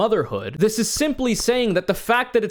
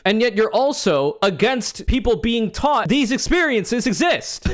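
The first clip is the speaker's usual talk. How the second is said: ordinary talk, nothing marked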